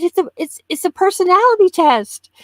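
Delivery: playfully overwrought; mock upset. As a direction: retell it as an exasperated defence. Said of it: "I'm still upset about it".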